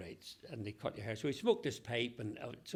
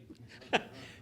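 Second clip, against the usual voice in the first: breathy laugh. Real, not acted. After the egg note punchline.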